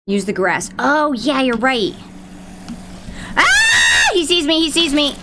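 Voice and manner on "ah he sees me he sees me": high panicked yell; panicked shout. Real, not acted. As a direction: scream it as you're spotted. Spotted by enemy, panics.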